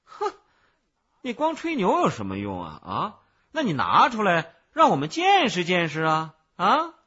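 loud, high, defiant dare; indignant challenge. A narrator's reading. Peddler dares the child to produce it, indignant. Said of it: "小贩不服气地说".

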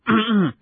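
loud, pointed throat-clear. A narrator's reading. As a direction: clear the throat before speaking up. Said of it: "赫敏清了清喉咙". (用力清嗓子)

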